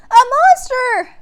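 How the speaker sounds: very high, loud, slow alarmed cry; girl crying out at a monster; frightened cry. A narrator's reading. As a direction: cry out in fear. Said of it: "Annie cried".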